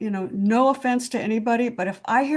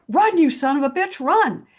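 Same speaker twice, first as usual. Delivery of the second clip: higher, louder mock cheering; imitated fan yell; 6 semitones higher, 4 dB louder than usual. Friend yelling at football players on TV, mock yell. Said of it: "she's like".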